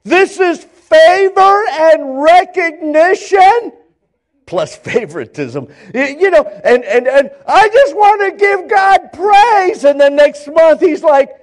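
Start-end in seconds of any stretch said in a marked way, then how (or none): 0.0-3.7 high, loud church testimony; hammed-up testimony of praise to the congregation; mock testifying. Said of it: "It's like he's testifying". 7.5-9.8 high, loud burst of praise; hammed-up church testimony; mock testifying. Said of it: "It's like he's testifying".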